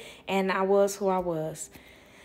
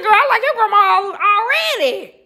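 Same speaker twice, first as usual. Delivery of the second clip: high, loud, laughing through the words; laughing delight; 11 semitones higher, 11 dB louder, faster than usual. Retold or imitated. Telling the stranger she loves her grandma, laughing.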